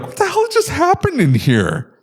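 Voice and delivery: high-pitched mock outrage; appalled disbelief. Coworker walking in after the tech, appalled. Acts out walking into messy bathroom.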